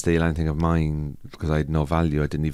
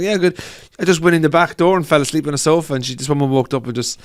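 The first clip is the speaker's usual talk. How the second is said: much higher, louder put-on voice of the man; Glasgow guy; 9 semitones higher, 8 dB louder than usual. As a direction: ham up the bewildered lad from the video. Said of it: "And she's like".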